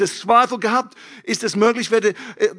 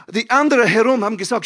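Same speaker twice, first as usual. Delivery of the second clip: ordinary talk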